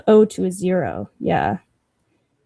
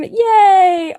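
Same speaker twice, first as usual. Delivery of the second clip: delighted cheer; 8 semitones higher, 5 dB louder than usual. Real, not acted. Delighted by gift idea.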